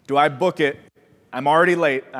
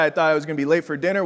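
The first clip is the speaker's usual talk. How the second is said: ordinary delivery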